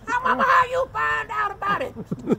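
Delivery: put-on high, slow pleading little boy; a scared little boy asks mama how she found out, high and slow; child voice. Boy asks his mama how she knew, high and slow.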